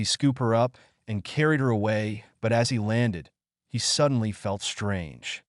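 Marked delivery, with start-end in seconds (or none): none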